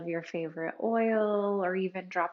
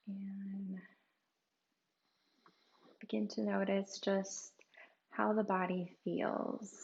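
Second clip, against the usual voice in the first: guided meditation; 5 dB quieter than usual. Acted. Guide leads listeners through a body scan for pain, softly meditative. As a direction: gently lead them inward, leaving room to breathe.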